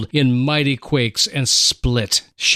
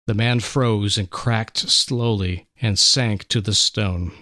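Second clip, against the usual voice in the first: ordinary delivery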